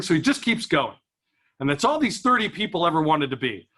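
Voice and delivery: usual speaking voice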